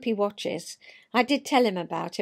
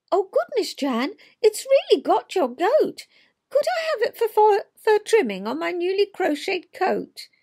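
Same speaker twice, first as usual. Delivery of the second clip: much higher, gushing friend's voice; excited cry; 9 semitones higher, 5 dB louder than usual. Acted. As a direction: squeal with delight at the mole fur. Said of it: "Judith cried".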